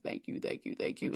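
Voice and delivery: whispered, crying thank-yous; tearful whisper. Retold or imitated. The crying mother at the window, whispered thanks. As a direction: breathe the thanks through tears. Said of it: "she's crying and she's like".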